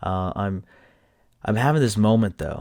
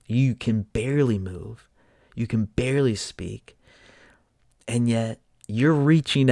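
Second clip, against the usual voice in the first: louder, amazed, laughing through it; excited disbelief; 3 semitones higher, 5 dB louder than usual. Retold or imitated. Son marvelling at his mother reaching out to nurses. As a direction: marvel at her out loud.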